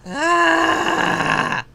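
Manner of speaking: loud cry, then a slow loud seethe; furious shout. Real, not acted. Gamer fuming after losing the pull, in slow loud fury. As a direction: stretch out every word in rage.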